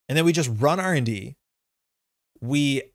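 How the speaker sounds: usual speaking voice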